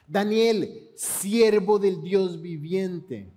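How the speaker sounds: siervo del Dios viviente drawn out slowly; slow, weighty title. Acted. Preacher names Daniel's identity for the congregation, slow and weighty. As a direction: let each word land, very slowly. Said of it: "¿Cómo te conocen?".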